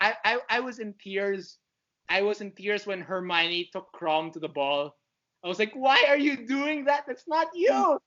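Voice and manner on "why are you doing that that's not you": high, loud, near-tearful protest, slowing to a cry; anguished plea. Retold or imitated. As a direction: beg a character to stop, voice cracking. Pleading with Hermione while reading.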